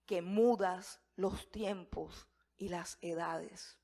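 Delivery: drawn out slowly, every word weighed; slow solemn reading. Shown as a demonstration. Preacher reciting Daniel's prayer to congregation, slow and solemn. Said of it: "repeats prayer line, then whispers it".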